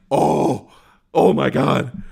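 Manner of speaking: delighted, loud oh my God; overjoyed and a little breathless; thrilled gasp. Retold or imitated. Thrilled at being called young.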